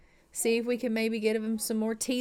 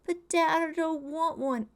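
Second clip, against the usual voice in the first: put-on whiny kid; 6 semitones higher than usual. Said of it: "And he's like".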